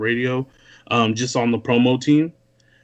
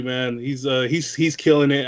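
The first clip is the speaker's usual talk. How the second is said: normal talking voice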